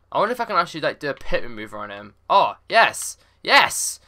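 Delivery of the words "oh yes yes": high loud yes; triumphant cheer. Real, not acted. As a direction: celebrate the win. Pit maneuver works.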